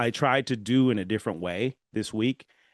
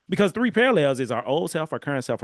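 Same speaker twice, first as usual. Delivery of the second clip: ordinary talk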